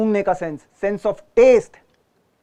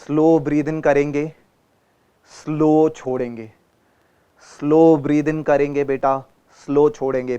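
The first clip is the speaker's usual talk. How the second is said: guided breathing; 3 semitones lower than usual. Acted. Guiding slow breathing.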